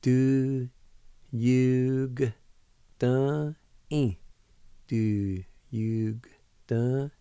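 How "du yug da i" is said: Du yug da i said slowly, one syllable at a time; syllables sounded out. Shown as a demonstration. Teacher sounding out Du yug da i for students. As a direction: split it into Du yug da i. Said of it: "pronounce that G with the Y U like this".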